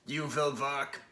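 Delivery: put-on stern German-accented command; a drill coach with a German accent. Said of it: "I'm doing a German accent for a Russian thing".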